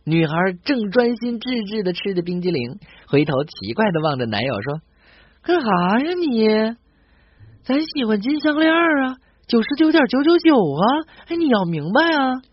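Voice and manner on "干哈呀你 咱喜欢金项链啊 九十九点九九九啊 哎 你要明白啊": put-on higher, brash northeastern Chinese accent; a blunt Shenyang girl demanding gold. A Shenyang girl eating ice cream answers her boyfriend, in a higher, brash northeastern accent. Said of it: "沈阳人是这样求爱的".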